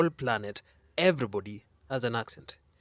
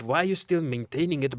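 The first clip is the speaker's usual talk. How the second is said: usual speaking voice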